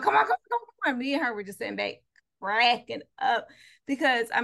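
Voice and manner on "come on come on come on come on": high, loud excited urging; egging him on. Retold or imitated. Employee's girlfriend to the husband, egging him on. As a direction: imitate someone goading him on. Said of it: "egging him on".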